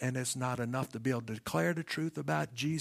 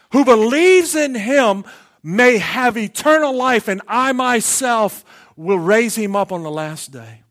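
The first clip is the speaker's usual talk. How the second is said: full-force preaching; 10 semitones higher, 19 dB louder than usual. Acted. Preacher proclaiming Jesus' kingship to the church, full-force preaching. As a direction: thunder the verse out to the congregation. Preacher drives the point home.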